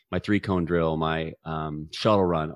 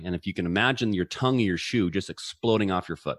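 ordinary delivery

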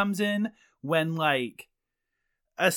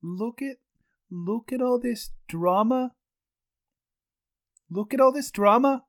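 attempted Canadian accent; 7 semitones higher than usual. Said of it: "Can you do a Canadian accent?".

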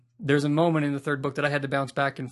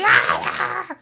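shrill, high wraith squeal on fire; a burning wraith shrieking as it flees; 9 dB louder than usual. Retold or imitated. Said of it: "that is a terrible Wraith impression".

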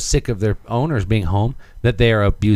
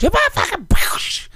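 much higher, a mock squeal of a Jerry Springer guest; mock catfight insult, pitched way up; trashy talk-show guest. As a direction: ham up a brawling talk-show woman. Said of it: "she goes".

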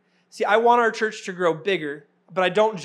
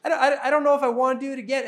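higher, fast hesitant whine; a tired dad unsure about one more spin; reluctant dad; 7 semitones higher, 5 dB louder, faster than usual. Retold or imitated. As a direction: waver about doing it again. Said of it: "And I'm going".

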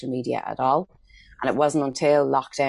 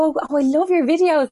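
much higher and louder, mock-adoring fan; gushing fan; 13 semitones higher, 6 dB louder than usual. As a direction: imitate a fan fawning over your videos. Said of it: "They go".

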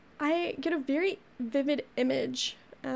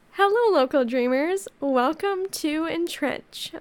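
podcast intro; 3 semitones higher, 8 dB louder than usual. Acted. Loud greeting.

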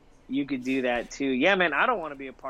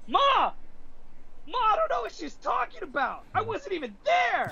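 put-on much higher-pitched kid voice protesting his innocence; kid protesting to mom. Gamer kid calls out to his mom mid-match, high protesting voice. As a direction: squeal it like a teen caught out by his mom.

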